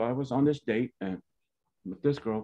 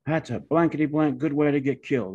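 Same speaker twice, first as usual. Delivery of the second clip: retold angry cussing; 5 semitones higher, 3 dB louder than usual. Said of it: "He's cussing".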